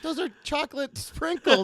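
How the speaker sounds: mock wife. Wife answering her husband, pitched high. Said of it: "She's like".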